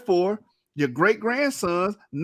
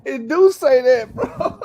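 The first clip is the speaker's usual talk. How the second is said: laughing; 6 semitones higher, 7 dB louder, slower than usual. Real, not acted. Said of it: "That's why I'm laughing".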